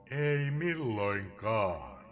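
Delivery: drawn-out, very slow refrain; slow tolling refrain; slow cry. Acted. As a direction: stretch each syllable of the refrain. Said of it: "Huudon tuon".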